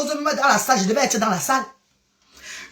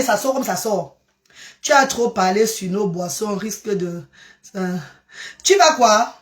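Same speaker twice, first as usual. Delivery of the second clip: normal talking voice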